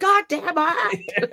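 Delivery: high, very slow exasperated groan of a curse; mock frustrated curse. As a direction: drag the curse out, pitch up high. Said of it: "And then you break it and you're like".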